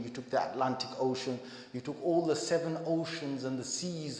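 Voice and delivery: hushed heartfelt sermon close; tender closing exhortation. Acted. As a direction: draw them gently toward tears.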